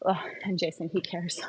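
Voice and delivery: soft breathy whisper under the breath; an aside about Jason, whispered. Real, not acted. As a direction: mutter it as a quick aside.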